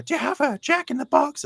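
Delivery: high-pitched, curious put-on voice; eager fan impression. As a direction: ask eagerly in a squeaky-high mimicking voice. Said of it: "this is literally how he's, uh, how he's speaking".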